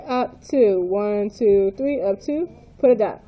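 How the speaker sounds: ordinary talk, nothing marked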